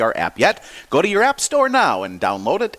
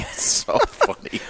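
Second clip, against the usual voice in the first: laughing; slower than usual. Real, not acted. Laughing at his own story.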